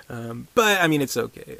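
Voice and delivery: normal talking voice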